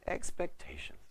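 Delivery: "Expectations" said softly, half whispered; hushed repeat. Shown as a demonstration. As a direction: drop to a breath on "Expectations". Repeats the key word hushed.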